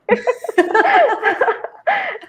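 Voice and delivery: bursts into a high, delighted laugh. Real, not acted. Mother laughing while retelling her son's first words.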